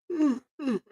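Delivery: big audible yawn. Real, not acted. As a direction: let out a long yawn. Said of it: "Excuse me for me, uh, yawning".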